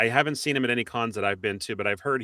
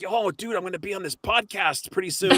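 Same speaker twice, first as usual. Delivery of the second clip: upbeat, higher hyped-up invitation; eager pitch; 8 semitones higher, 4 dB louder than usual. Pretend. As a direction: sell the podcast with eager enthusiasm. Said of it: "That's what you gotta say".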